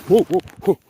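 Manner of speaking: high, startled whoa. Real, not acted. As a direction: react to slipping. Slipping on the ice.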